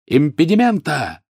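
high, drawn-out shouted incantation; yelled spell. A narrator's reading. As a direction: cast the spell with a long high cry. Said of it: "завопил он". (протяжный выкрик заклинания)

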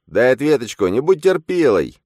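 a shrill furious inner double; angry double. A narrator's reading. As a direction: shout at him to wake up. Phantom continues.

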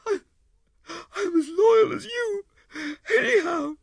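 high, loud tearful woman's voice; sobbing. A narrator's reading. Wife defending herself to her husband, sobbing. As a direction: sob through the words. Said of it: "Then she began sobbing".

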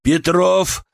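calling out while commanding. A narrator's reading. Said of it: "продолжал я".

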